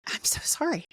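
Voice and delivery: choked-up near-whisper of apology; emotional, apologetic. Real, not acted. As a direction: whisper it, overcome with feeling. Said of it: "it still makes me emotional".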